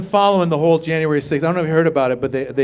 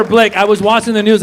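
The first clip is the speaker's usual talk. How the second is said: normal talking voice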